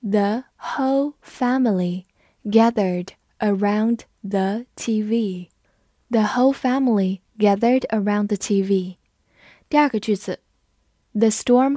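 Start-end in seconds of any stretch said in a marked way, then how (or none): 0.0-5.5 slow reading, each word set apart; measured teacher's model for repeating; slow read-through. Shown as a demonstration. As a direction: read word by word with short pauses. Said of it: "我们慢读一遍".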